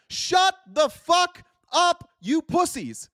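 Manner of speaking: enraged outburst at the whiners; furious shout. Real, not acted. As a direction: tell them off at full volume. Ranting at MAGA critics.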